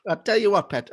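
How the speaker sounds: high-pitched Geordie catchphrase; Geordie accent. Shown as a demonstration. Warming up the accent on stock phrase, Geordie. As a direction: lift it up into Geordie. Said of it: "I wanna hear your Geordie accent".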